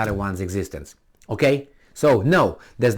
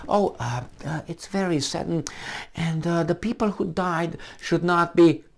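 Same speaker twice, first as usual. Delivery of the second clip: soft, hesitant, higher mealy-mouthed voice; mock timid condolence; 5 semitones higher, 5 dB quieter than usual. Showing how not to respond, timid hesitant mock sympathy. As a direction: hem and haw delicately. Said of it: "That's not how we talk".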